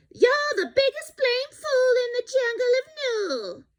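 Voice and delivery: a tiny joey taunting from the pouch; little kangaroo voice. Acted. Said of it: "the young kangaroo in her pouch said".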